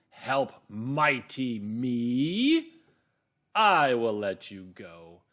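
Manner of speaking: loud, slow, mocking mighty lion; big lion voice. Shown as a demonstration. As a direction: boom a scornful laugh, then stretch the words with contempt. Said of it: "So the lion's voice is big and".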